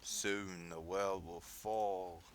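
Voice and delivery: menacing doom prophecy, very slow; dark lord. Acted. Ominous villain monologue.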